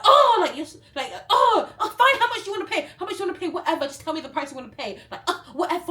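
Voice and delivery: high, loud, laughing mock yell; shrill hammed-up shopkeeper yell; mock yelling vendor. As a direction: ham up the angry seller. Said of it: "she starts yelling at me".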